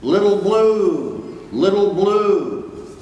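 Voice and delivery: slow, high, far-off echoing call; distant calling. Acted. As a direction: call out from far across the sea. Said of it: "his Papa was calling him".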